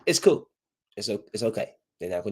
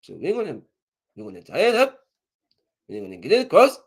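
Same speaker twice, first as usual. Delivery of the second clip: put-on Mexican accent. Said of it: "I can see the Mexican like".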